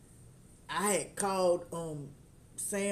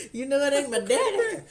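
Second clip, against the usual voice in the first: high, louder laughing talk; 8 semitones higher, 8 dB louder than usual. Real, not acted. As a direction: laugh through the words.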